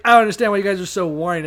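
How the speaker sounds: mock pampered Moses. Mocking Moses complaining to Israelites.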